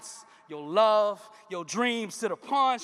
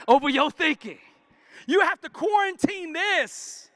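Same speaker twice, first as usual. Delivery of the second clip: rising fervent sermon delivery; preaching; 3 semitones higher, 6 dB louder than usual. Real, not acted. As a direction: preach it, pushing the congregation. Preaching at full force.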